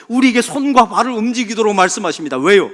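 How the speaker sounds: loud, high preaching climax; full-force preaching. Acted. Pastor drives home his point to the congregation, preaching loudly. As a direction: preach it out to the pews.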